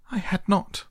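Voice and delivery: high, sharp angry retort. A narrator's reading. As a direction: snap it back in anger. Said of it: "cried the mouse sharply and very angrily".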